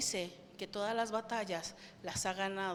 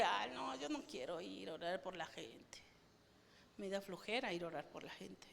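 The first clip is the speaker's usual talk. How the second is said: low, very soft mock-lazy whine; lazy reluctance; 3 semitones lower, 10 dB quieter than usual. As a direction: drop to a weary near-whisper, too lazy to bother. Mimes lazy refusal to pray.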